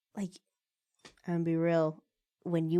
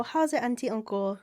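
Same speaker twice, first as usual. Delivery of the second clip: raised, friendly Hawaiian Pidgin; light Pidgin lilt, pitched up; Pidgin greeting; 5 semitones higher than usual. Retold or imitated. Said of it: "I'll speak Pidgin".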